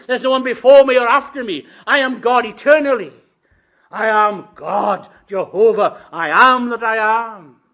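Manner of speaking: loud, high, preached voice of God; full-force divine proclamation. Acted. Preacher voices God answering Moses at burning bush, full-force proclamation.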